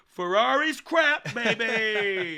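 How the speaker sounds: loud, slow, high ironic cheer; mock hype. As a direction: cheer it sarcastically. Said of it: "we're like".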